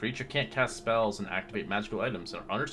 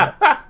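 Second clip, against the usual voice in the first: burst of laughter; 15 dB louder than usual. Real, not acted. DM delighted by roleplay.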